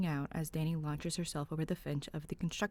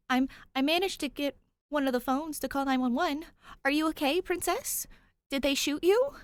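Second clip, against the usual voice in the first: put-on higher, anxious boyish voice; worried young ghost; 10 semitones higher, 6 dB louder than usual. Tyler checking if Danny was shot, higher worried voice. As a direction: fret over her as you rush in.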